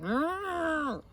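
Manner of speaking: loud, rising engine growl; high-revving vocal engine imitation; revving engine noise. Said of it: "hear the engine revving up".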